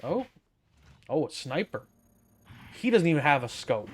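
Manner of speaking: normal talking voice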